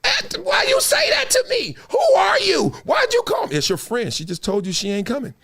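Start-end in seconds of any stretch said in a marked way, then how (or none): 0.0-3.6 high shrieking yell at a caller, close to crying; a child screaming at the phone, voice cracking; mock phone yelling. Kid yelling into the phone, high and shrill. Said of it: "looking at the phone yelling".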